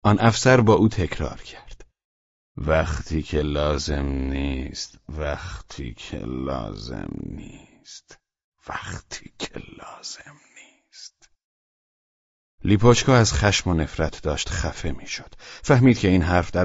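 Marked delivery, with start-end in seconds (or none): 2.6-11.3 slow, fading from soft to a whisper; half-asleep echo dying away; drowsy repetition. A narrator's reading. Sleepy officer repeats the phrase.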